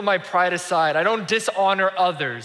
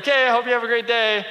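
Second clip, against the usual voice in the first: higher, quick imitated farewell; polite brush-off; 5 semitones higher, 4 dB louder, faster than usual. Speaker escaping the homeless man, quick high-pitched polite farewell. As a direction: chirp a hurried goodbye to get away.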